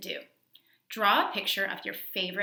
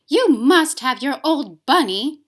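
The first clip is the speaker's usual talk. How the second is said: louder, higher, slow grumbling nursemaid; grumbling Nana; 6 semitones higher, 14 dB louder, slower than usual. A narrator's reading. Nana grumbles at the boy while rubbing off the bunny, louder. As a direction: grumble it like a put-upon nanny. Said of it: "she said".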